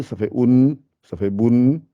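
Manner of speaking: "bun" ending on a clear n. Shown as a demonstration. Teacher demonstrating the n ending, "bun" with clear n. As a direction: land the n in "bun". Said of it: "avec le n".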